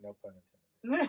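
chuckled out, slow and high; amused, drawn-out laughing aside. Real, not acted. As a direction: laugh through the joke. Jokes about hustling pun.

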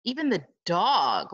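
plain reading voice

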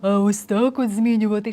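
lightly manipulated, artificial voice; change the voice on purpose; unnatural altered voice. Shown as a demonstration. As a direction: put on a slightly contrived voice. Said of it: "неприроднім таким чином".

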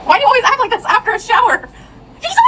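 very high sing-song pet voice. Real, not acted. Talking to the dog.

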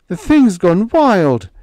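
slow, high and loud, strangled; choked fear. A narrator's reading. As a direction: choke the words out in terror. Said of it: "Higgins choked".

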